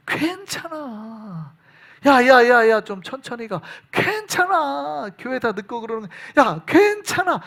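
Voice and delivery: slow, coaxing tempter voice; drawn-out honeyed whisper of temptation; sweet-talking Satan. Retold or imitated. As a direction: lure them in gently like a smooth tempter. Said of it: "사탄은 항상 우리에게 그렇게 말합니다".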